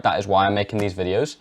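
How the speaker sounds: usual speaking voice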